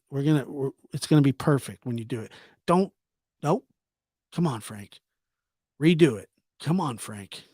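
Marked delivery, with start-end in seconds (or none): none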